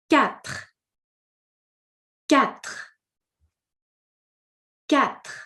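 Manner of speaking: quatre started with a hard K; quatre with a K sound. Shown as a demonstration. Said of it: "Q U is pronounced like a K".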